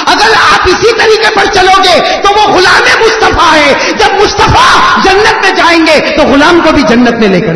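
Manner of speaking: loud, high preaching with laughter; fiery preaching. Acted. Preacher promising paradise to the crowd, loud and joyful.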